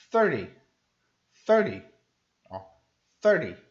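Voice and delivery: thirty hit hard on THIR, short ending; stress on THIR. Shown as a demonstration. Teacher contrasting with thirteen, thirty stressed on THIR. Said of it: "o foco fica no começo".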